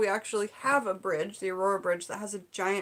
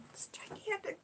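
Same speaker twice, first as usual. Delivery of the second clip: whispered in awe; hushed amazement; awed whisper; 11 semitones higher, 12 dB quieter than usual. Real, not acted. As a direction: breathe it out like a secret. Awed at big gift.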